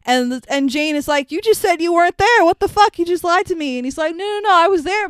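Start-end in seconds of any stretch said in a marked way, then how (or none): 1.3-3.8 high, loud retold confrontation; acted angry accusation. Acted confrontation over a lie. 4.2-5.1 high, loud mock backpedalling; defensive protest. Acted defensive backpedal when accused.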